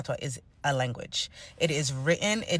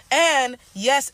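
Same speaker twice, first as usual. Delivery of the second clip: a louder, higher And yes; emphatic yes; 7 semitones higher, 6 dB louder than usual. Real, not acted. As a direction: push back with a firm yes. Emphatic rebuttal.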